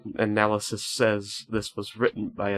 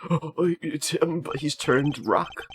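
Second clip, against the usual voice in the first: slow, higher-pitched gasped line; acted astonishment; 5 semitones higher, much slower than usual. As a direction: draw it out in hushed mock shock. Gamer voices a startled character.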